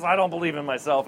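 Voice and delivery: normal talking voice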